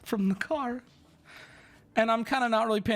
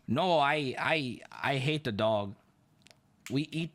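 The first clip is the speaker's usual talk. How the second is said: imitated Chinese-accented driver, low and halting; 7 semitones lower than usual. Uber driver answering about dogs in his car, Chinese accent. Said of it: "with a very heavy Chinese accent".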